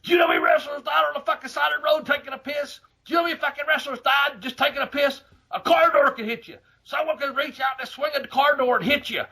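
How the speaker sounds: high, fast yelling rant as Neidhart; high-pitched mock tirade at the driver; mock furious rant. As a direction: scream it fast and high like a wild wrestler. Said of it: "he goes".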